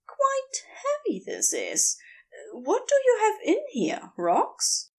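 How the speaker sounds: a puzzled quip under the weight; Aziraphale's voice. A narrator's reading. Aziraphale voiced, straining with suitcase.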